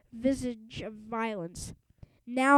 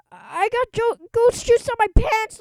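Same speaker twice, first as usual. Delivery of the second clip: high, loud, near-tearful wail; a bully crying over his soaked pants; blubbering lament; 11 semitones higher, 10 dB louder than usual. Acted. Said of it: "Loogie wailed".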